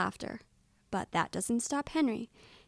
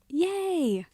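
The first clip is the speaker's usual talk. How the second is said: high, drawn-out happy yay; story character celebrating; excited cheer; 8 semitones higher than usual. Acted. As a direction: cheer in relief, pitch soaring. Henry celebrates hiccups gone.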